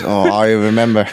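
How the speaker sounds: lightly voiced Cockney Picard; Cockney accent. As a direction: drop into a Cockney accent, remembering. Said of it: "his accent reverts back to Cockney".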